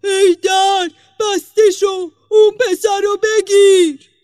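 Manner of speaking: high, very loud shouted command; demon's roar. A narrator's reading. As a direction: bellow orders as a furious demon.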